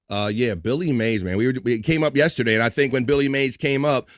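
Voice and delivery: ordinary delivery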